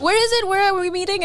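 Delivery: high, eager-to-please questions; keen phone bluff. Retold or imitated. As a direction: ask the caller keenly, pitch way up.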